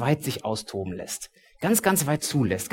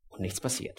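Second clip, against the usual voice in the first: whispered, much softer; a breathless whisper of emptiness; hushed; 2 semitones lower, 13 dB quieter than usual. A narrator's reading. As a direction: let it drop to a breath. Dramatic silence after crucifixion.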